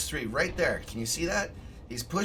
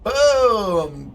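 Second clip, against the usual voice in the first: excited shout; 7 semitones higher, 12 dB louder than usual. Real, not acted. Streamer yelling at the replay, loud excited boom. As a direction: belt out the hit like a commentator. Tank gets rammed on replay.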